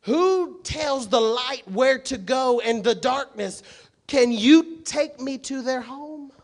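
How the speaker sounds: high, loud rapid-fire questions; booming preaching. Acted. Pastor voicing God questioning Job, loud preaching. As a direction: fire each question from the pulpit. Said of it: "he says".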